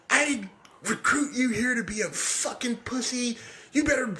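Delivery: high, furious coach tirade; imitating a coach screaming at a player; coach yelling. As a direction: tear into the player like an angry coach. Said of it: "just read me the riot act".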